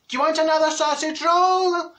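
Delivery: bellowing kindly at the deaf; mock carer shouting. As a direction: raise the voice like talking to the hard of hearing.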